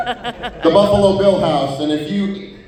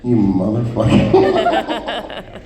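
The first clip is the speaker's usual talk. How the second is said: slow low curse; 6 semitones lower, much slower than usual. Retold or imitated.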